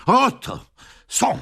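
high, loud cry broken by a pause; excited cry of discovery; breathless with impatience. A narrator's reading. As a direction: gasp out the sighting impatiently. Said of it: "zawołał zadyszany z niecierpliwości".

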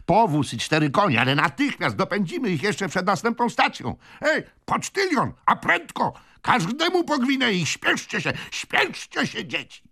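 high, excited hurrying orders; rally everyone to hurry; old gentleman frantically giving orders; urging on. A narrator's reading. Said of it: "Zachęcając w taki sposób".

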